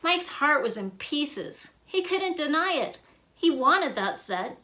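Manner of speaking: plain reading voice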